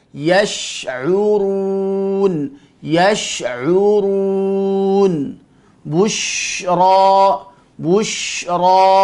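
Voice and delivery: slow words with a long hushing SH in YASH'URUN and BUSHRA; shin given its full quality. Shown as a demonstration. Teacher drilling letter shin, lengthened SH in each word. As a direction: hold the SH, then stretch the vowel. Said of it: "tunaikan dulu sifatnya".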